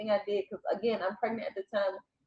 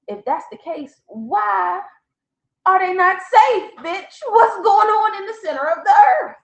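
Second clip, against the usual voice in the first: much higher and louder, laughing on bitch; exasperated demand; 12 semitones higher, 10 dB louder than usual. Retold or imitated. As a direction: throw the questions at her, half laughing, half outraged. Demanding answers from her friend.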